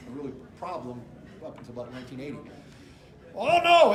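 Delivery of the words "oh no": loud, high attempt at a Scottish accent; a failed stab at a Scottish accent; a Scot from Glasgow refusing to leave, badly done Scottish accent. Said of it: "I can't do his accent".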